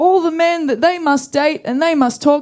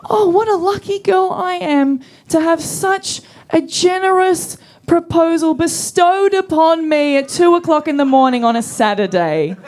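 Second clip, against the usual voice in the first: sarcastic sweetness; 5 semitones higher than usual. Retold or imitated. Barmaid thanking the sleazy man with mock sweetness. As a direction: lay the gratitude on thick to mock him. Sarcastic reply to bar proposition.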